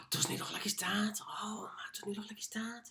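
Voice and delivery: gasp, then hushed mock amazement; mock whisper. Host mimicking onlookers at his dad's picture, mock-amazed whisper. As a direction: whisper it like an astonished onlooker, playfully.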